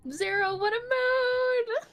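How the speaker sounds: high, slow, gushing; high excited voice. Retold or imitated. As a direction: squeal it with delight. Excited quote of sister.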